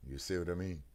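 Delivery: ordinary delivery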